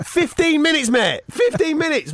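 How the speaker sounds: loud, high-pitched comic outrage; ranting to a mate, voice way up; exasperated. Real, not acted. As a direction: hammer it out in disbelief. Exasperated rant about wasted time.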